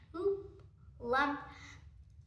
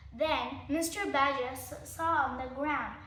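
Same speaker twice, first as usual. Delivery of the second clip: ordinary delivery, nothing marked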